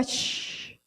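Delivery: siseo soplado como carne en la sartén; chisporroteo imitado. Retold or imitated. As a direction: imita el filete friéndose con un shh largo. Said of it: "suena como cuando tú fritas un filete". (imitando el sonido de la llama al caer, un siseo de fritura)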